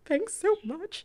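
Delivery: high, slow, mock-weepy gratitude; retold emotional thanks. To the friend who drove over to hug her, mock tearful. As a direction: squeak it out slowly, as if overcome.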